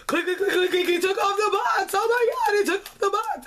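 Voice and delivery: high, squealing fan excitement; excited fans. Retold or imitated. Fans seeing Primary take off the box, squealing high. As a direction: gush like a starstruck fan at the reveal. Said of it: "Everyone was like".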